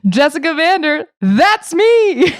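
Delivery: high, loud mock TV-host sign-off; hammed-up catchphrase. Traveler tries out her Travel Channel catchphrase, high and loud. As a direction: belt it out like a show tagline. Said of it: "my Travel Channel catchphrase is".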